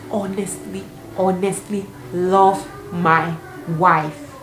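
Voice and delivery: slow, word-by-word declaration; acted solemn confession. Married boyfriend confessing to his girlfriend, slow and halting. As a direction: imitate him spacing out each word with pauses.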